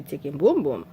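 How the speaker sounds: normal talking voice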